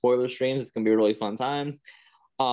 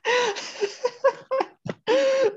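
laughing. Real, not acted. Reacting to Chris Pratt voice.

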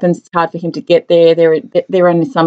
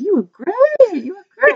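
delighted captain; 12 semitones higher than usual. Retold or imitated. Retelling a film scene, the captain gushing with delight. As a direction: gush over the performance.

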